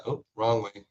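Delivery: usual speaking voice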